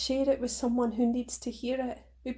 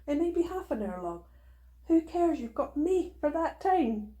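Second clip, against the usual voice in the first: ordinary talk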